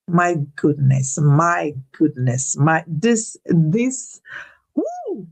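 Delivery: ordinary talk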